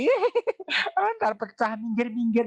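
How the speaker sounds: put-on a mom brushing the toddler aside, impatient; mock irritated mom pushing the kid off; annoyed shooing mother. Mother shooing child from dishes.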